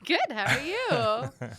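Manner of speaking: drawn-out, loud, playful hello back; mock radio greeting. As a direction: echo the host's big on-air voice, slowly. Co-host returns the radio-style greeting.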